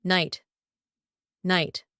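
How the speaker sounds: "Night" with gh silent; silent letters. Shown as a demonstration. Teacher giving test answer, silent gh in "Night". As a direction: say "Night" like nite, falling. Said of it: "There we have two silent letters".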